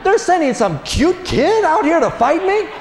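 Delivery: high indignant mock giant; high outraged mock Goliath; offended giant. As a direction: voice the giant's insulted disbelief.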